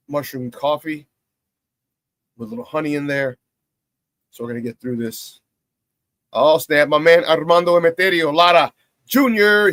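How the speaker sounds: ordinary talk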